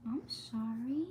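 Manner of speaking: hushed slow apology; whispered sorry. Real, not acted. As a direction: breathe an apology to the pup. Apologising to the dog.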